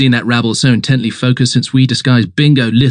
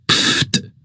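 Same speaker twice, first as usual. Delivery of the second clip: pfft; 5 dB quieter than usual. Acted. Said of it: "No use pfting, Jeeves".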